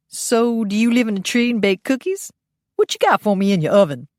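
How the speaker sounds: camp, bright greeting to a pal; sassy Phineas. Acted. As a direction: tease her like a cheeky friend. Phineas flirting teasingly.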